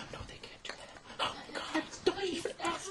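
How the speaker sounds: gasp, then a breathless whisper; shocked whisper. Pretend. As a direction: hush it like a scandal. Mock shock about promoters.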